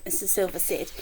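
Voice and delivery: plain reading voice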